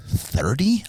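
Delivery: high, rising "Thirty?" in disbelief; shocked disbelief. Retold or imitated. Hearing a friend's huge income, stunned disbelief. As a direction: react like the number can't be real. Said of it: "I was like".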